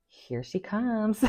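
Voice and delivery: soft, high, slow drawn-out tease; mock announcing taunt. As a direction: stretch it out quietly, imitating a taunt. Repeats the mimicked taunt.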